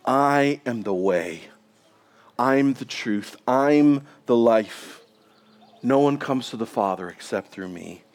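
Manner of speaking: Jesus gently answering confused Thomas, tender; gracious and gentle. Pretend. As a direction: speak it with quiet humility.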